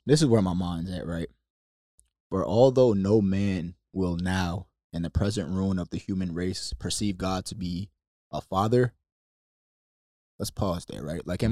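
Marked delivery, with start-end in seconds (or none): 2.3-8.9 reading aloud. Acted. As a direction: read it like a solemn quotation. Rereads Calvin quote.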